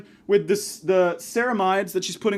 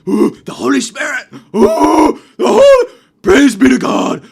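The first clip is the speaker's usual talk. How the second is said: higher, louder, slow gasping praise; mock holy-roller rapture; 6 semitones higher, 13 dB louder, slower than usual. Comic imagining the kid in the stall, mock ecstatic praise. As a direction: ham up a man seized by the Holy Spirit.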